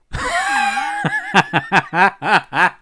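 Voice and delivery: high-pitched burst of real laughter; long, uncontrollable laughing fit; helpless laughter. Real, not acted. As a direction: crack up completely, pitch shooting up. Overcome by laughter at the game.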